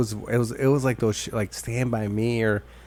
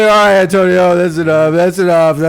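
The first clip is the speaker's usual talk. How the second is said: louder, higher put-on voice of a fed-up teacher; stern teacher; 6 semitones higher, 9 dB louder than usual. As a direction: shut the kid down, loud and firm. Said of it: "He's like".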